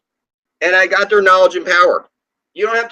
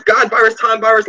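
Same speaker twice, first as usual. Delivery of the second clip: ordinary talk, nothing marked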